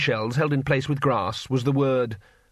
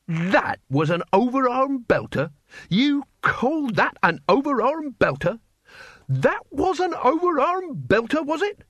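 rising, mocking disbelief; higher and higher, scorning the boy's blow; incredulous; 11 semitones higher than usual. A narrator's reading. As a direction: climb higher with each scornful question. Mocking indignant repetition.

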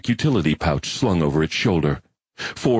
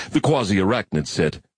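usual speaking voice

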